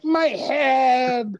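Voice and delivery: high, loud acted wail; mock pained cry. Actor retelling a botched fainting stunt, mock pained wail. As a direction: wail it as if you'd banged your head. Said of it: "And I'm like".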